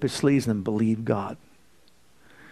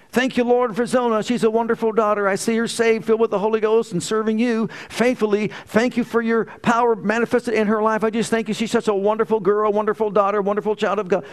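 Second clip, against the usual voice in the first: high, loud joyful thanksgiving; fervent prayer; 8 semitones higher, 3 dB louder than usual. Retold or imitated. Father praising God on his knees for his daughter. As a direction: pour out thanks to the Lord with fervor.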